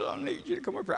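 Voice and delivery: high-pitched imitation of a crying man; mock tearful plea. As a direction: whimper the request, hammed-up. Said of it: "If you quit crying".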